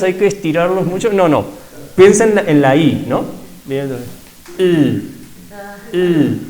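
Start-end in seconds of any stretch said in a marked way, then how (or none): 4.6-6.4 I said with lips half closed, tongue as for U; sixth vowel demo. Shown as a demonstration. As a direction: model the vowel I slowly, lips barely spread. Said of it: "los labios van medio cerraditos".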